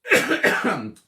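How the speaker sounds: loud clearing of the throat. Real, not acted. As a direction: clear your throat hard. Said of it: "excuse me, I'm gonna cough again".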